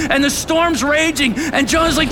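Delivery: high, loud, driving preaching; impassioned pulpit shout; preaching at full force. Real, not acted.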